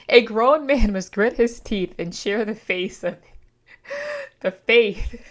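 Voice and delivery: hammed-up loud proclamation; grand declamation. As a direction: declaim it through laughter. Rereads quote grandly, laughing.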